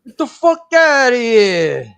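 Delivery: loud, high, drawn-out Bronx holler; Bronx accent. Shown as a demonstration. Showing the others how they say it, hammed-up Bronx accent. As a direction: holler it slowly like a Bronx local. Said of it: "how they say it with the Bronx accent".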